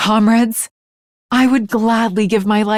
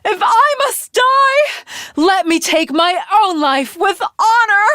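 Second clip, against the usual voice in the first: high, crying shout of despair; anguished cry; 12 semitones higher than usual. Acted. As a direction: cry out, refusing to go down without honor. Desperate anguished outcry.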